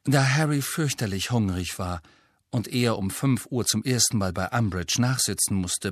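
ordinary delivery

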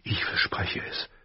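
whispered promise. A narrator's reading. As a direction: breathe the promise to Lyanna. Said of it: "flüsterte er". (geflüstertes Versprechen)

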